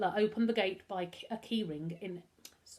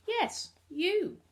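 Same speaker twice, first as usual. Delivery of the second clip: put-on high, louder reply with a French accent; 11 semitones higher, 5 dB louder than usual. A narrator's reading. Butler confirms to the boy he is meant, French accent. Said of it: "It was a French accent".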